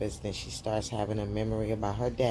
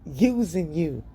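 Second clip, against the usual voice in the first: much higher and louder, put-on mother voice; hammed-up mother warning her daughter; mock warning mother; 9 semitones higher, 4 dB louder, slower than usual. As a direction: warn her daughter in a high loud voice. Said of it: "Her mother says".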